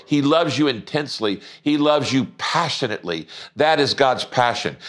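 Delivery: normal talking voice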